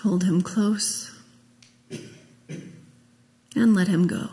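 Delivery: normal talking voice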